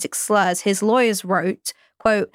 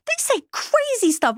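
exclaiming; 14 semitones higher than usual. Real, not acted. Exclaiming about metal lyrics.

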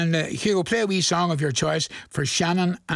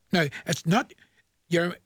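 usual speaking voice

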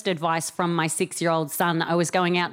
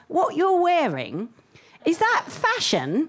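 put-on higher, slow kid's question; innocent child voice; 8 semitones higher than usual. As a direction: ask it slowly like a curious little boy. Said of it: "he goes".

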